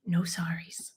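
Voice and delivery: whispered aside. Real, not acted. Coach whispering the rule to the audience.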